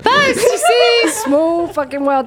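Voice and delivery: thrilled at the coincidence, high and loud; excited disbelief. Real, not acted. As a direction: burst out, stretching the words in amazement. Discovers they grew up nearby.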